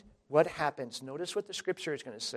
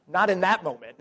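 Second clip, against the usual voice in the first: words broken by laughter; preacher chuckling at his own joke; laughing; 4 semitones higher, 8 dB louder than usual. Real, not acted. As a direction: laugh through the line.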